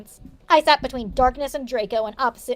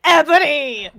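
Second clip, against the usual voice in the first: slow, a touch louder villain call; lightly voiced Darth Vader calling Ebony; mock evil villain; 4 dB louder, slower than usual. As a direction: drag out the names like a mock villain. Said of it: "said evilly in his raspy voice".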